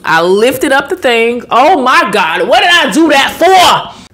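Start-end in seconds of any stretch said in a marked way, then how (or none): 1.5-4.1 hammed-up "what have I done" outburst; mock regret. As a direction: wail it slowly like a huge blunder. Mock regret at her own mistake.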